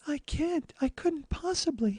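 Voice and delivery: high, soft, slow coy starlet voice; hushed, high mock-demure delivery; Marilyn Monroe. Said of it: "Please do a Marilyn Monroe voice".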